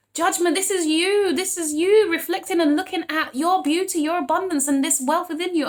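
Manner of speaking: high, stretched-out affirmation; real uplifting hype; exultant. Real, not acted. Exulting over the judgment card.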